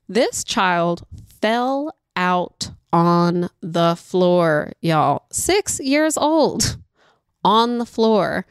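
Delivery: slow, stretched-out words for emphasis; slow dramatic storytelling emphasis; drawn-out disbelief. Retold or imitated. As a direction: draw each word out, incredulous. Incredulous storyteller drawing out the tantrum.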